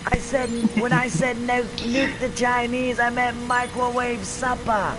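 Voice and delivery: grab him by the collar and say it; angry collar-grab quote. Retold or imitated. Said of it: "and he's like".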